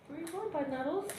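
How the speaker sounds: high cooing pet voice. Real, not acted. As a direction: coo at the cat. Talking to cat.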